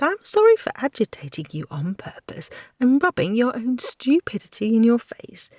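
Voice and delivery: a lightly voiced cheeky girl sneering through it; sarcastic young woman. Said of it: "voiced in whisper".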